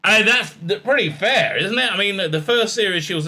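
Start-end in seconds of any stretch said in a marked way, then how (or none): none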